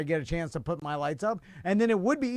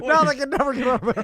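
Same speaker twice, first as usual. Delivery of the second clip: mock horrified fan; 5 semitones higher, 4 dB louder, faster than usual. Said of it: "I'm like".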